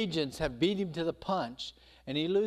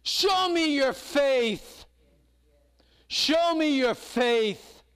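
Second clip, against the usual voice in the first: slow, louder "Show me your faith" both times; slow loud demand; 9 semitones higher, 5 dB louder, much slower than usual. Acted. God challenging his people, demanding faith loudly. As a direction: call the challenge out and drag it. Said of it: "I should say".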